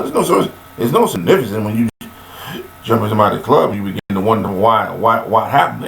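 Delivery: ordinary talk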